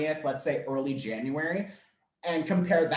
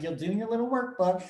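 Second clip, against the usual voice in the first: higher, playful self-parody of talking down; mock condescending; 4 semitones higher than usual. Workshop host mocking her own remark about workbooks, mock condescending. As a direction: imitate yourself patronising a student. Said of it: "that sounds so condescending".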